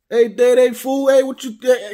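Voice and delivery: hammed-up Chicano street slang, loud and high.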